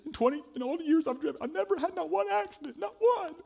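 high, upset woman fighting tears; teary lady. Retold or imitated. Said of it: "trying to fight back tears".

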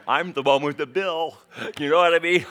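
loud, comic protest to the audience; mock indignation. Real, not acted. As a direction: raise your voice in playful outrage. Mock outrage about crying caller.

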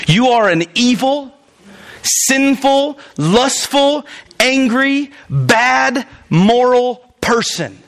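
high, emphatic preacher list; each word hammered up high; rising preaching intensity. Real, not acted. Preacher to congregation, hammering each sin high. Preacher listing sins at listeners.

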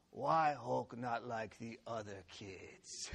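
low, slow, mock-plaintive monster; a brute's drawn-out existential angst; Hulk. Boy to his mom at bedtime, mournful Hulk voice. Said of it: "he says to his mom".

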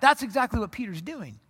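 ordinary talk

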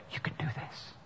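unvoiced, soft whisper of encouragement. Loved one whispering to a struggling friend, softly. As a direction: breathe it close into the ear. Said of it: "The whisper in my ear".

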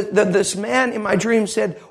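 usual speaking voice

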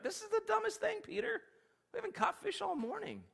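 grumbling fishermen fed up with Peter; whiny complaining friends. Acted. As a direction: whine at Peter.

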